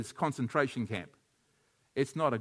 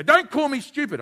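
son protesting; 12 semitones higher, 9 dB louder than usual. Retold or imitated. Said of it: "my son used to say".

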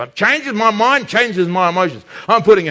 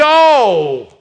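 exasperated groan; 5 dB louder than usual. Retold or imitated. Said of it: "I'm just".

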